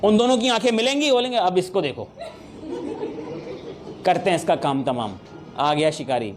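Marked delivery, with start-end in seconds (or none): 1.4-6.3 low, sly plotting voice; scheming gossip. Retold or imitated. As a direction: whisper it like a conspirator. Mocking rivals plotting together. (धीमी, चालाक साज़िशी आवाज़)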